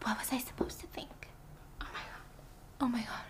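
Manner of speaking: breathy, stunned whisper to herself; panicked whisper. Acted. A panicked Birdie whispering to herself in shock. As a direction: whisper it like the scandal just sank in.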